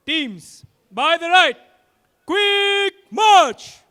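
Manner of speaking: long, drawn-out shouted command; drawn out parade commands. Real, not acted. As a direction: bark it across the parade ground.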